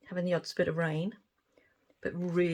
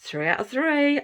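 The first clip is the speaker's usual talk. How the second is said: excited cheer; 8 semitones higher, 8 dB louder than usual. Real, not acted. Another success.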